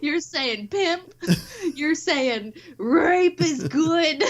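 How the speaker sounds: high, slow, laughing whine; real laughing protest; laughing disbelief. Real, not acted. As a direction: gasp it through laughter. Incredulous laughing accusation.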